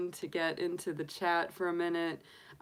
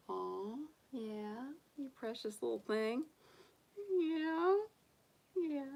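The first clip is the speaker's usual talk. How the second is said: softer, tender cooing to the pet; 5 semitones higher, 5 dB quieter than usual. Real, not acted. Owner settling her bunny in with hay, soft baby talk.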